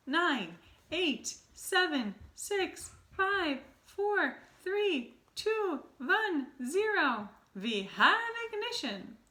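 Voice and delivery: very high, loud, building witch countdown; Grand High Witch counting down Bruno's transformation; gleeful countdown. Acted. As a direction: count it down, shrieking with glee.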